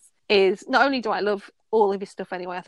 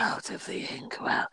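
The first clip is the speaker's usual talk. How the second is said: slow whispered demon voice; demonic whisper; 7 dB quieter than usual. Pretend. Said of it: "Is it the demonic voice?".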